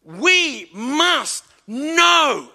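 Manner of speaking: know held long and slow; stress on know. Shown as a demonstration. Preacher stressing know to the congregation, slow emphasis. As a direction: lean hard on know, drawn out. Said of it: "emphasis on know".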